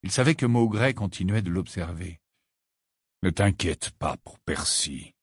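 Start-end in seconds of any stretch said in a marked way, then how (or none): 3.2-5.1 low, slow, curt reassurance; abrupt. A narrator's reading. Sirius reassures Missus Wesley, abrupt. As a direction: say it abruptly in a lower register. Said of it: "dit brusquement Sirius".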